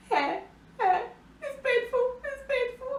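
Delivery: high, thin whimper of pain; mock crying. Crying in the car over her sore hair, mock whimper. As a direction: whimper it like a hurt child, hammed-up. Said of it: "I'm crying".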